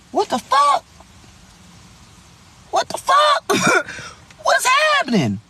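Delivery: two friends reacting to a shocking voicemail; stunned disbelief. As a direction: gasp it out in shocked amusement.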